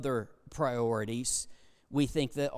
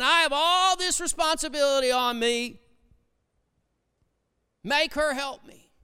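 aggrieved Martha complaining; 9 semitones higher, 4 dB louder than usual. Retold or imitated. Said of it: "she's all upset".

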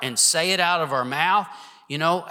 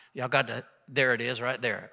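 usual speaking voice

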